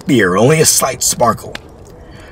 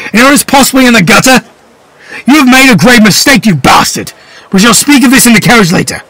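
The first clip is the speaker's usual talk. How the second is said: high, loud furious rant; father raging at his son; furious scolding; 12 semitones higher, 6 dB louder than usual. Acted. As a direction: berate the son. Father berates son outside.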